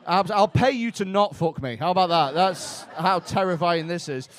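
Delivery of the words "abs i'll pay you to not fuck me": ordinary talk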